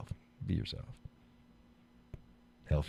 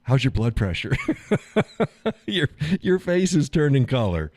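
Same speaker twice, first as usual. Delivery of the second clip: teasing wife; 9 semitones higher, 4 dB louder than usual. Retold or imitated. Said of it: "She's like".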